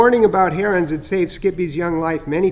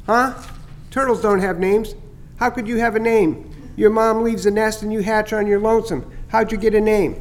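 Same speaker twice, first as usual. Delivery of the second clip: higher, chirpy bird voice, pleased with his prank; teasing starling; 5 semitones higher than usual. Acted. Starling Chatter teasing Skippy about the scare, higher and chirpy. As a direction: gloat a little over the fake heron scare.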